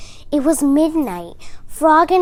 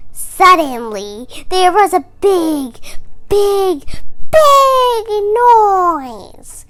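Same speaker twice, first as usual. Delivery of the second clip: louder and higher with each big; building dramatic storytelling; 8 semitones higher, 10 dB louder, slower than usual. A narrator's reading. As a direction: build the big noise up to a cry.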